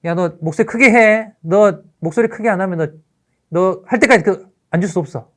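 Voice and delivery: loud, high-pitched teacher's reprimand; stern scolding. Acted. Teacher threatening to keep pupil standing, stern scolding.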